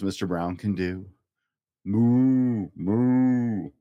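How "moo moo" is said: a louder moo; loud cow moo. Acted. Dad reading to a child, loud moo. Final sound list.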